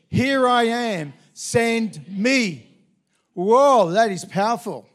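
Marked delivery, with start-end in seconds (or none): 0.1-2.7 loud, slow, raised offer; fervent answer. Acted. Isaiah answering God in the reading, fervent and loud. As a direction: give yourself fully, out loud.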